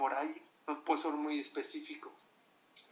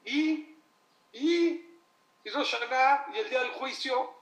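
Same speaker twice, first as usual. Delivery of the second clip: excited; 6 semitones higher, 5 dB louder than usual. Acted. Excited crowd building up.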